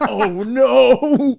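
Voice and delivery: a hammed-up gasp of doom; mock dread. Realizing he is headed to boot camp, mock dread.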